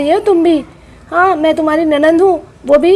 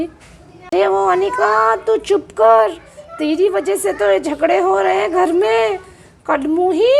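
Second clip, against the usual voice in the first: scolding; 4 semitones higher than usual. Acted. Aunt scolds Anika.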